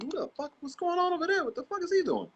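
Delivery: high, squeaky bewildered voice; mock confused onlookers; baffled onlookers. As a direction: mimic stunned people staring at the screen. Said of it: "They were looking like".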